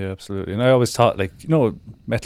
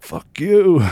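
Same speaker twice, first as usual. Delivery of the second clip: loud, raised, drawn-out defiant shout; high, loud mock-lyric yell; mock angry yell; 10 semitones higher, 6 dB louder than usual. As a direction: playfully belt out a defiant jab. Voicing angry lyrics sung along.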